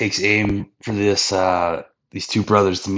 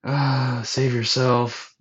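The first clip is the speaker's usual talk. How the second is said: mock dying; 6 semitones higher, much slower than usual. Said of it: "kinda acting dying".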